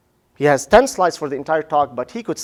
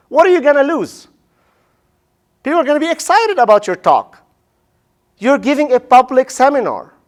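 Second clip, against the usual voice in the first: excited; 8 semitones higher, 4 dB louder than usual. Real, not acted. Urging speakers toward catchy titles.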